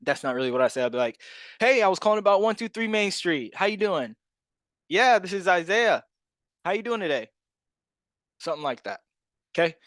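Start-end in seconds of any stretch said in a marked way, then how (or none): 1.6-7.3 much higher, upbeat sales voice; eager, pitched-up cold-call hello; high-energy phone greeting. Shown as a demonstration. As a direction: open the call bursting with enthusiasm. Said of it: "That's literally how I sound".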